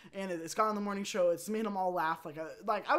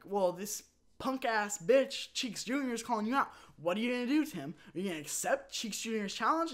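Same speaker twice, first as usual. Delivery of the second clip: higher-pitched mock goading challenge; Greg Miller; 5 semitones higher, 3 dB louder than usual. Host goads colleague about a call-out, mock challenge. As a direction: egg him on, raised and emphatic.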